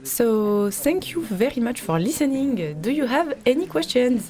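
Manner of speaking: put-on slow English with a French accent. Doctoral student closing her talk, slow French-accented English.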